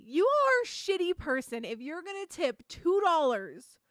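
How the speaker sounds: ordinary talk, nothing marked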